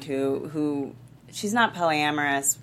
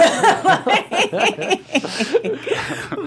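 burst of laughter; 8 dB louder than usual. Real, not acted. Laughs at her own joke.

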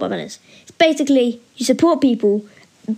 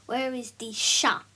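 fake French; 10 dB quieter than usual. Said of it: "in French?".

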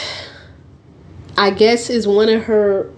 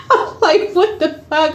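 laughing; 7 semitones higher than usual. Retold or imitated. Retelling her shocked reaction.

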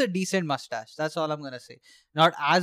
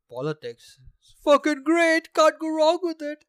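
oversell it with sarcasm; sarcastic; 9 semitones higher, 6 dB louder than usual. Real, not acted. Bitter joke about politics.

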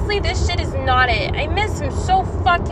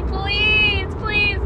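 mock begging; 7 semitones higher than usual. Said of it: "let me beg a little bit and say".

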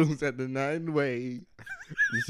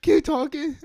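much higher, excited mock plea; mock eager fan; 13 semitones higher, 3 dB louder than usual. Imitating listeners begging them to continue, high and eager. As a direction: squeak it up high, thrilled. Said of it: "they be like".

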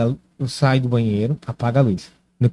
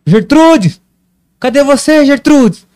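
put-on high loud old man calling; 11 semitones higher, 7 dB louder, much slower than usual. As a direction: call out for a lost wife. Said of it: "o avô dela". (velho chamando alto)

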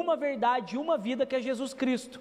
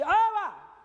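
calling back; 8 semitones higher, 3 dB louder than usual. Retold or imitated. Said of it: "Aí eu falei assim".

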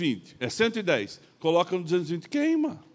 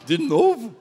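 loud, high laughing disbelief; laughing exasperation; 4 semitones higher, 10 dB louder, slower than usual. Retold or imitated. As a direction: burst out in amused protest. Exasperated at drunk's repeated line. (incredulidade rindo, em voz alta)

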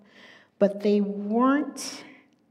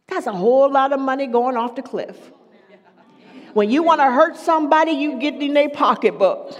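much louder, higher and fast; preaching climax; 4 semitones higher, 8 dB louder, faster than usual. Acted. Preacher driving home the point, loud and fast. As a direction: punch it out in a rising crescendo.

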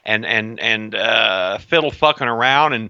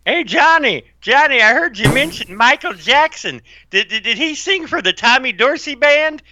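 put-on old-timer voice; 11 semitones higher, 4 dB louder than usual. Joking put-on voice to cohost.

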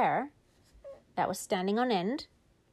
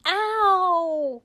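high, loud yelp of pain; 9 semitones higher, 7 dB louder than usual. Acted. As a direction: yelp as if something yanked. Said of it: "He went".

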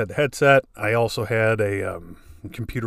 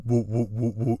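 a lightly mimicked stammer; repeating the stuck syllable, mock stutter; imitating a stutter; 3 dB quieter than usual. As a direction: caught on wha again and again. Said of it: "through a headset with his wha".

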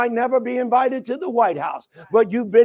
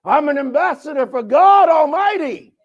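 high, swelling proclamation; proud declaration; 4 semitones higher, 4 dB louder than usual. Shown as a demonstration. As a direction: proclaim it proudly, pushing up on God. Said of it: "You gotta be proud".

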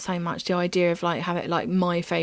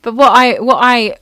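ordinary talk, nothing marked

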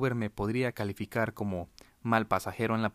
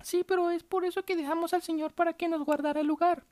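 whiny lady voice. Retold or imitated.

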